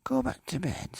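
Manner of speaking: high stern call to bed; sharp parental order; parent's call. Acted. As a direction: send the child back to bed. Parent calling child to bed.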